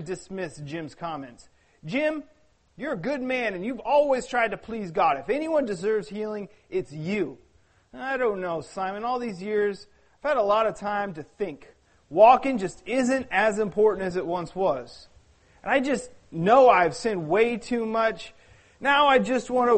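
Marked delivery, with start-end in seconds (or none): 1.8-7.4 reassuring. Acted. Simon reassures Jim softly. 8.0-19.8 sad. Acted. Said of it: "exhaled sadly".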